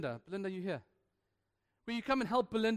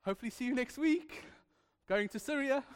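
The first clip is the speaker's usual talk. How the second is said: breezy goodbye; 6 semitones higher than usual. Retold or imitated. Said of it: "get in his car and say".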